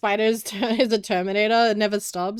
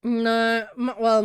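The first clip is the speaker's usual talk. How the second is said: ordinary talk, nothing marked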